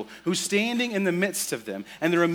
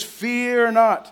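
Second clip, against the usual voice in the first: loud, higher angel announcement; angel's proclamation; 6 semitones higher, 7 dB louder than usual. Acted. Angel declaring to the shepherds, loud proclamation. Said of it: "He says".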